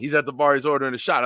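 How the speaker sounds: usual speaking voice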